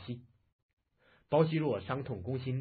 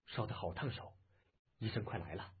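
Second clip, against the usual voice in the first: very soft, slightly lowered voice; hushed voice; 4 semitones lower, 9 dB quieter than usual. Acted. Man worrying over a feverish patient, hushed voice. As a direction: speak gently under your breath. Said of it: "轻声道".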